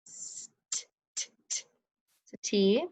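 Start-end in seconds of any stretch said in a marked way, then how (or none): 0.1-1.6 "S, t" as bare voiceless consonant sounds; isolated sounds. Shown as a demonstration. Teacher asking what is next, "S, t" sounded separately and softly. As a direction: make "t" a short, soft tap of sound. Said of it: "What's next".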